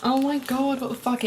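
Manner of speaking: high, loud laughing disbelief; shocked laugh. Real, not acted. Reader laughing in shock at the scene, high and loud. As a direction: burst out in shock.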